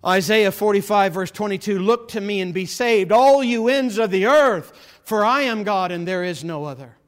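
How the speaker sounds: loud scripture proclamation from the pulpit; full-force preaching. Real, not acted. Preacher calls all ends of the earth, loud proclamation. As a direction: call the verse out to a whole crowd.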